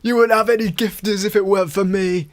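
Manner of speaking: high, loud sing-song taunt; mocking CLA. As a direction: gloat like a smug mentor. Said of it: "She's playing the".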